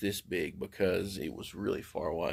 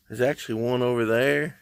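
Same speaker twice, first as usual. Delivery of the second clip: normal talking voice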